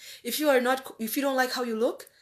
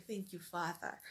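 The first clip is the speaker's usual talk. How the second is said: soft prayer; 2 semitones lower, 14 dB quieter than usual. Retold or imitated. Quoted prayer of thanks.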